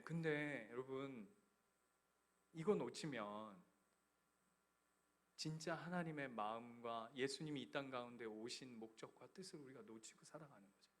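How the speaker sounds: ordinary delivery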